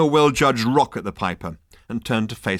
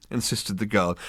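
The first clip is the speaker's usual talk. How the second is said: ordinary delivery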